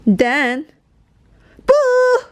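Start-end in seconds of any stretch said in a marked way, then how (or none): 1.7-2.2 shout. Acted. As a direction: jump out and yell boo. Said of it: "Biff and Chip shout".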